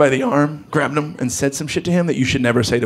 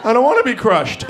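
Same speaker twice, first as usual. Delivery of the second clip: put-on high small kid voice; scared kid; 9 semitones higher than usual. Six-year-old answering dad, high scared kid voice. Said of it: "A six-year-old will believe that shit".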